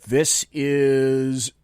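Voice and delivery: ordinary talk, nothing marked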